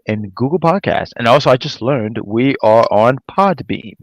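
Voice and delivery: low, fast radio announcer plug; deep promo read for the podcast. Pretend. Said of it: "That was my announcer voice".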